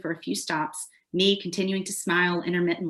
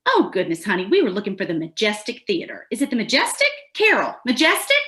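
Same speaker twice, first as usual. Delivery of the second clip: Southern tourist; 3 dB louder than usual. Acted. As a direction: play a flustered older Southern tourist checking with a friend. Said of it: "usually a Southerner over the age of fifty".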